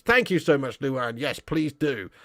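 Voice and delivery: ordinary talk, nothing marked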